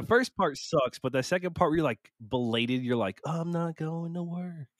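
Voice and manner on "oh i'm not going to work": higher and slower, a relieved sigh of a line; relieved quote. Retold or imitated. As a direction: savour the realisation that work is off. Relief at a day off.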